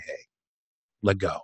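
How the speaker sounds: soft, low, hushed coaxing; quietly imitated reassuring whisper; gentle whisper. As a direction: murmur it like a trusted friend at the ear. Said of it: "whispers in your ear from the back".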